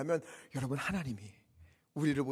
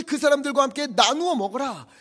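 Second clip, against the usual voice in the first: raised, commanding scripture proclamation; forceful preaching; 8 semitones higher, 12 dB louder than usual. Acted. Preacher quotes the leaders to the weeping people, forceful preaching.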